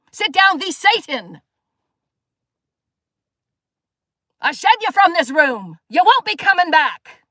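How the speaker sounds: preacher banishing Satan, slow and rising; commanding rebuke. Acted. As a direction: cast the devil out with slow authority. Casting Satan out of room.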